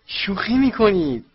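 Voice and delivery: high, loud, drawn-out disbelieving cry; student blurts out amazed at the headmaster's news; loud outburst. Acted. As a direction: shout it out across the hall in disbelief. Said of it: "فرد ویزلی با صدای بلند گفت".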